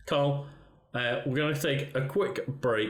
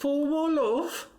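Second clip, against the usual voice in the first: put-on drawn-out, very high German kid voice; high-pitched mock German accent; Uter German accent; slower than usual. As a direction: stretch it out like a German cartoon child. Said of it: "that German kid from The Simpsons accent".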